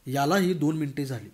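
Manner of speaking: usual speaking voice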